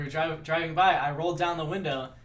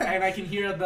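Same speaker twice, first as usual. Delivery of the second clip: ordinary talk, nothing marked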